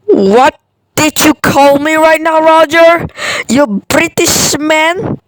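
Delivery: loud, indignant demand; offended. Acted. Offended at being called spiteful.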